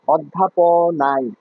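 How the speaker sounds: অধ্যাপনায় drawn out syllable by syllable; slow, spelled-out correction. Shown as a demonstration. Teacher reading a student's word slowly to mark the error. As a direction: slowly lay out অধ্যাপনায় for the class. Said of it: "অধ্যাবসায় না".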